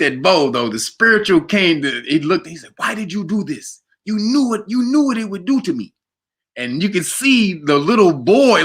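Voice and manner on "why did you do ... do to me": high, wounded Jamaican reproach, imitated; Jamaican accent. Betrayed roommate accusing Bo, pleading Jamaican accent. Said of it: "he was Jamaican".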